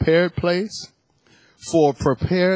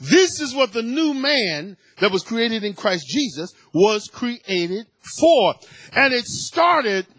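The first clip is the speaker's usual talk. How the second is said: fervent preaching; 7 semitones higher than usual. Real, not acted. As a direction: drive the point home to the congregation. Preaching at full force again.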